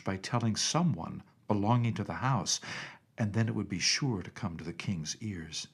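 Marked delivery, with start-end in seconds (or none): none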